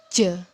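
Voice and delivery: J as "juh" with extra buzz; vibrating J sound. Shown as a demonstration. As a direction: say J with the throat vibrating. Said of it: "tapi ini lebih ada getaran". (bunyi J dengan lebih banyak getaran)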